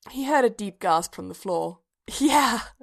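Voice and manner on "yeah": breathless laughter. A narrator's reading. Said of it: "still half breathless with laughter".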